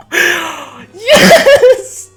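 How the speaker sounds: high, loud laughing yes; triumphant yell. Real, not acted. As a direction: punch the air with it. Laughing after winning.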